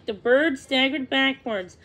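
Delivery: ordinary delivery, nothing marked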